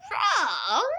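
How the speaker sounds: put-on high, sugary old woman's voice, a touch foreign; sweet old lady voice. A narrator's reading. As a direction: coo it like a kindly grandmother hiding a threat. Auntie Em feigning innocence.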